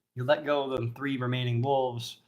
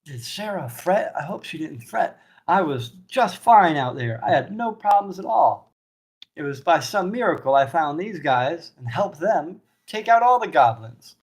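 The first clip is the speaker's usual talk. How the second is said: put-on higher, bragging young man; boastful Wallace; 5 semitones higher, 4 dB louder than usual. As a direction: brush off the worry with bravado. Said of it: "And Wallace is like".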